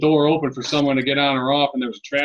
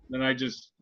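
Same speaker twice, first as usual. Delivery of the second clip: ordinary talk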